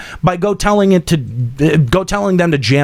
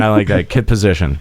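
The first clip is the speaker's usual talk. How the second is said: mock deep voice; 8 semitones lower than usual. Repeating co-host's phrase to tease, in a mock deep voice. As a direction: drop way lower into a silly movie-villain growl.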